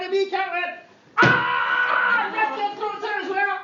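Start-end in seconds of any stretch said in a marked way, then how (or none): 1.2-2.2 high, loud cat screech; cat attack yell. Acted. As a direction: screech as it pounces again.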